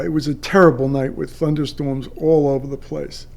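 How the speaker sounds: ordinary delivery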